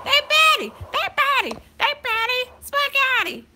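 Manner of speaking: teasing high-pitched refrain; squealed sing-song. Pretend. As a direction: chant it in a playful high squeal.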